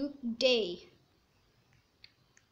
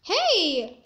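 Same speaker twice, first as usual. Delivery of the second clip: indignant shout; 7 dB louder than usual. Real, not acted. Player keeps killing him.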